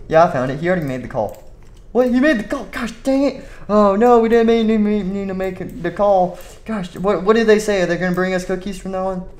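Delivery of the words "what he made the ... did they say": panicked boss. Acted. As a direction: panic about the call. Boss panics about call.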